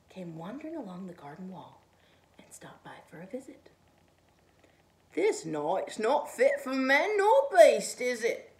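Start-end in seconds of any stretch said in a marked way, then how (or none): 5.1-8.5 loud, slow, higher cat drawl; a chilly stray cat making small talk, loud and slow. A narrator's reading. Cat greets the snowman on a cold night, loud and slow. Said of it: "said the cat".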